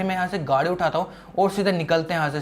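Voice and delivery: ordinary talk, nothing marked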